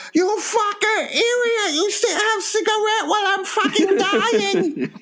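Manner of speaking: high-pitched mock-angry Chinese accent; Ray's Chinese accent. Near-drowned mate berating his rescuer, hammed-up Chinese accent. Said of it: "you're doing the accent".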